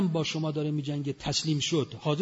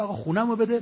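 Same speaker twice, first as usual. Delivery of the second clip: imitating the victim, louder and higher; indignant demand; 6 semitones higher, 6 dB louder than usual. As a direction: cry out for your house back. Victim demands his house back.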